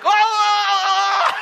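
sudden yell. Retold or imitated. Tom shouting again.

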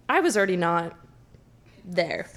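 normal talking voice

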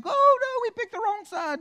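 loud, high cartoon demon in dismay; panicked demons. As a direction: yelp like a cornered demon. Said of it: "the demons are like".

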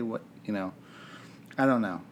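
ordinary talk

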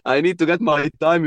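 laughing through the words. Real, not acted. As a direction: chuckle while saying it. Laughs about skipping studies.

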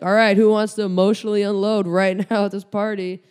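loud, bright call to the room; hammed-up call to the party crowd; mock party announcement. Comic telling friends at a party, loud mock call.

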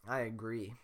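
normal talking voice

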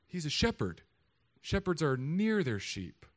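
ordinary talk

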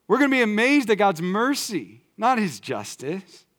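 usual speaking voice

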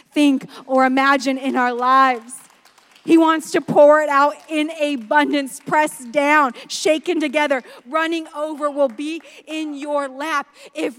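loud, proclaiming abundance; full-force preaching. Acted. Preacher promising abundance to the congregation, loud.

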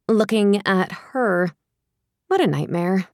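ordinary delivery, nothing marked

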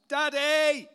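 high, loud, long-drawn yell; imitated child's holler from another room; child yelling for dad. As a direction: call "Daddy!" across the house like a kid. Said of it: "I will hear in the background".

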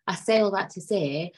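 ordinary talk

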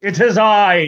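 high, grand mock British accent; hammed-up British accent. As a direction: announce yourself like a pompous Brit. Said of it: "Now he's British".

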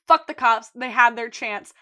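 loud, defiant declaration; defiant shout. Retold or imitated. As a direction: bark it out. Mandy's defiant quote voiced.

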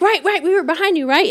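high, pressing retold appeal; seeking backup. Friend asking the girls to vouch, high insistent appeal. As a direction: pitch it up, pushing for agreement.

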